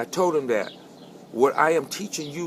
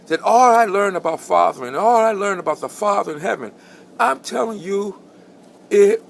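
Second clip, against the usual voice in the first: high, loud, swelling preacher's cry; preaching at full force; 6 semitones higher, 6 dB louder than usual. Acted. Pastor testifying to the congregation about fathering, preaching at full force. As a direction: build the sermon to its peak.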